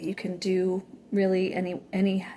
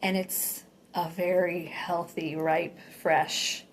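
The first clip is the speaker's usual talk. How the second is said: guided meditation; 4 dB quieter than usual. Acted.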